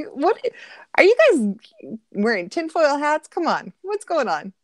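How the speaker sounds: high-pitched incredulous questioning; amused disbelief. Real, not acted. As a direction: protest as if they are all crazy. Incredulous at viewers' Putin questions.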